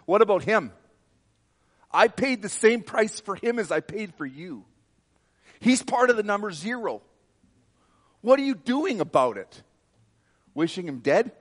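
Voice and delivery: loud, high, thundering divine rebuke; voice of the Lord rebuking. Retold or imitated. The Lord rebuking the preacher for wishing the leader dead, loud high fury. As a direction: rebuke him as God, raising pitch and volume. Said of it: "And the Lord said".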